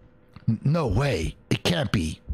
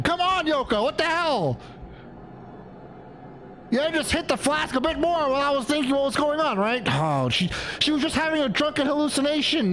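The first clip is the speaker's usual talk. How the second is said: put-on much higher, breathless telling of her drunk friend; high put-on character voice; 14 semitones higher than usual. Gamer voicing the flustered friend, high-pitched.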